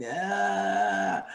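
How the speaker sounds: low drawn-out mock groan; groan of the fallen. Retelling a battlefield of flattened players, mock groan. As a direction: imitate bodies moaning on a battlefield.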